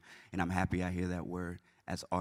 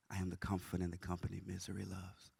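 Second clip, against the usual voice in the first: quiet echo; 9 dB quieter than usual. Acted. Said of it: "repeated softer closing line".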